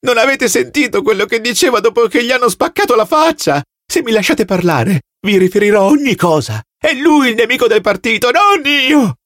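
terrified prisoner accusing another man; frantic shrieking plea. Acted. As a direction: plead hysterically to save yourself.

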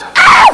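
very high chimp screech; chimp shriek. Acted. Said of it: "the little chimp up front goes".

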